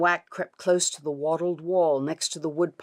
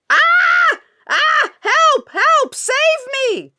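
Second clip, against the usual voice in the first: high, loud cries for help; screaming for help; 11 dB louder, slower than usual. Acted. Dirty Ulf calling for help from the tub, loud high scream. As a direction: yell for rescue in panic.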